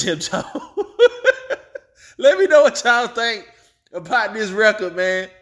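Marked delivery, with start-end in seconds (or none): 0.5-1.8 high-pitched cackling laugh. Real, not acted. After teasing [name] about the computer, high laugh. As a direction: crack up in a high giggle. Laughs at his own jab.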